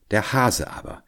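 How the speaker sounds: ordinary delivery, nothing marked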